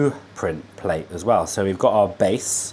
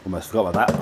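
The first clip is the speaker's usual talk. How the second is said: ordinary delivery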